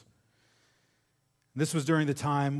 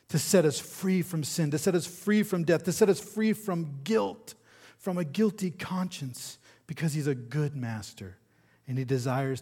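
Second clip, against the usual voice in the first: high, loud, driving repetition; impassioned preaching; 5 semitones higher, 6 dB louder than usual. Real, not acted. Preacher to congregation on being set free, fervent. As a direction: build each phrase like a fervent preacher.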